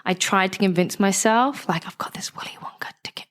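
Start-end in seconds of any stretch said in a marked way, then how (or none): 1.9-3.3 whispered secret thought. Retold or imitated. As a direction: breathe it like a guilty secret.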